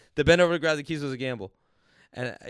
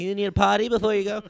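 higher, louder mock parent-to-toddler voice; mocking baby talk; 6 semitones higher, 8 dB louder than usual. Mom treating her grown son like three, mocking baby talk. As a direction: exaggerate talking down to a small child.

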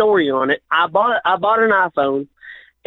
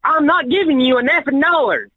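shouting match; 7 semitones higher than usual. Acted. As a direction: yell back at him, furious. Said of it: "please stop yelling at me".

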